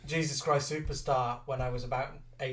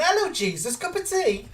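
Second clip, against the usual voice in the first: high, sweet mock old-lady offer; older woman; 6 dB louder than usual. Older actress offering Jesus tea, high and chirpy. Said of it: "And she went".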